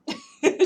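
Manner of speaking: sudden very high squeal of laughter. Real, not acted. Owner delighted by her cat, squealing laugh.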